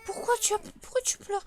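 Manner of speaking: very high, whiny imitation of crying; mock crying voice. Mimicking the crying baby in a high whine. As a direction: whine it like a sobbing child.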